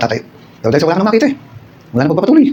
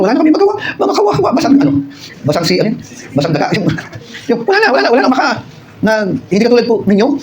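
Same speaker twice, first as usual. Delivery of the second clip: pitiful high whine; 7 semitones higher than usual. Acted. Mocking helpless orphaned church.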